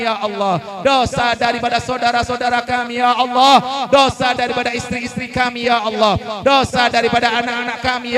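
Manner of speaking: loud, fervent supplication with each ya Allah called out; fervent prayer. Real, not acted. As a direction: lead the congregation in pleading prayer. Leads closing prayer. (doa penutup yang lantang dan khusyuk)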